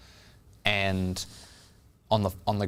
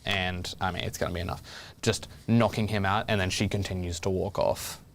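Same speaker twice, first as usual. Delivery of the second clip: usual speaking voice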